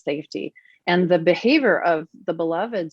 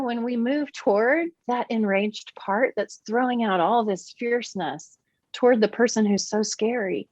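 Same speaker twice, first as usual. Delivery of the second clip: usual speaking voice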